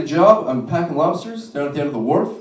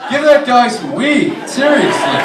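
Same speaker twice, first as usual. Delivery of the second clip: louder final call; building to a shout; 7 semitones higher, 9 dB louder than usual. Shown as a demonstration. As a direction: punch the last punchline.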